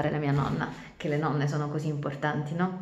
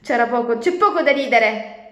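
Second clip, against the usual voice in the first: higher, louder angry turtle; furious shout; 6 semitones higher, 8 dB louder than usual. Acted. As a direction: yell back, stung by the mockery. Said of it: "gridò Nicole infuriata". (urlo furioso della tartaruga)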